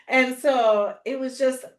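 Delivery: ordinary delivery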